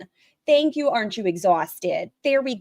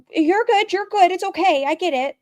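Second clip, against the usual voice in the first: mock reassuring daughter; 10 semitones higher than usual.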